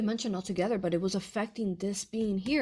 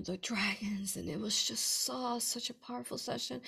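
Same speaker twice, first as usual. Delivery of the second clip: ordinary talk, nothing marked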